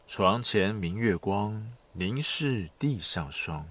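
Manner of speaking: poem recitation. Shown as a demonstration. Quoting a Tang poem.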